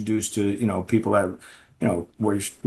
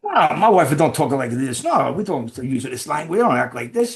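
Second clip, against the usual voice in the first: higher, indignant mobster voice; imitating the American mob boss; 4 semitones higher than usual. American mob boss defending his wife, indignant and raised. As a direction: answer like a proud old-school wiseguy. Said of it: "And he goes".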